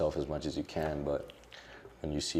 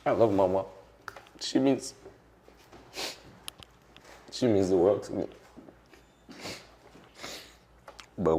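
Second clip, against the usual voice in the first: tearful; 3 semitones higher, 3 dB louder than usual. Real, not acted. Guest crying to the interviewer about his mother's disappointment. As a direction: push through while crying. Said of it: "I've been crying a lot this week".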